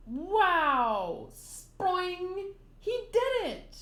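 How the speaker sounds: rising from a loud wow into high-pitched glee; amazed cheering. Acted. Gaimon watches Luffy spring up the cliff, amazed cheering.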